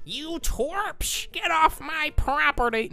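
high mock tough voice, far above her own; fake tough guy. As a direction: sneer it like a cartoon villain. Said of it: "trying to sound tough by saying".

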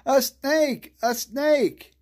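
high, loud panicked yell; yelled warning. A narrator's reading. Freddie warning the others of a snake, loud yell. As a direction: shout the alarm twice. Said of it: "yelled a warning".

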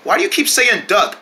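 usual speaking voice

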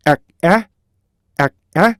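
"에, 에" as two beats parted by a K stop. Shown as a demonstration. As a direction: stop the first "에" with K, release the second. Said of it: "K로 나온다 했죠". ("에, 에" 사이를 K로 끊어서)

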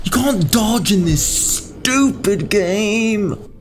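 high-pitched, drawn-out rage; real gamer rage, stretched and high; frustrated yelling. Real, not acted. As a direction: wail each word out in exasperation. Attacked by dogs, raging at game.

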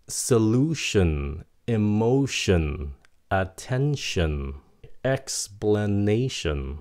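slow word list, "tion" said "shin"; "shin" endings. Shown as a demonstration. Teacher gives examples, "tion" as slow clear "shin". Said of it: "T I O N là âm shin".

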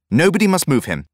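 high, loud order; firm command. A narrator's reading. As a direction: stop everyone at once. Student gives firm order.

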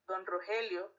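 ordinary talk, nothing marked

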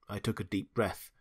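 usual speaking voice

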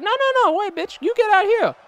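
player's wrestler refusing to enter the ring; high mock refusal. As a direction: whine your way out like a scared heel. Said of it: "I'm like".

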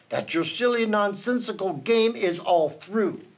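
ordinary delivery

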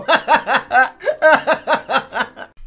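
loud, high, rapid-fire cackle; long, helpless burst of laughter; real laughing fit. Real, not acted. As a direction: crack up loudly at your own story. Cracks up at his coke story.

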